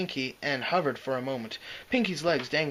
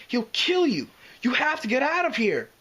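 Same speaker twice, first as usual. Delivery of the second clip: high, loud frantic plea; pleading with his friend to flee; desperate pleading; 10 semitones higher, 5 dB louder than usual. A narrator's reading. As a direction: beg her to get away now. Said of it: "Spike pleaded with her".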